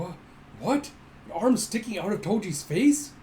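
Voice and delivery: hammed-up shock at a bizarre sight; shocked disbelief. Reacting to an arm in Toji's face, hammed-up shock. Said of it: "You go".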